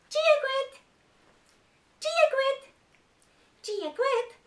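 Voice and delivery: a tiny excited puppet shouting hello; puppet character voice. Acted. Puppet greeting the children in Irish, high and squeaky. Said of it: "they've come to say hello again".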